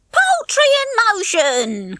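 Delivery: high, slow, drawn-out jeer; wisecracking. A narrator's reading. Nick jokes as Bunty whizzes past, mocking. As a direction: stretch the joke out as it flies past. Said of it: "cracked Nick".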